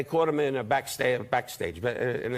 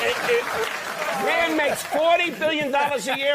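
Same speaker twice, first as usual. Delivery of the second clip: mock outrage; 11 semitones higher, 4 dB louder than usual. Real, not acted. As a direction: bellow it in mock disbelief. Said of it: "shouted outrage at interviewer".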